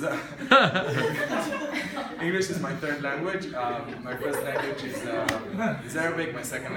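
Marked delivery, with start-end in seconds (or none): 0.2-2.1 a big burst of real laughter at herself; hearty laugh. Real, not acted. Speaker laughing at her own joke about her English, bursting into laughter. 3.5-5.1 laughing. Real, not acted. Still laughing from the joke.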